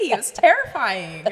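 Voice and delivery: ordinary talk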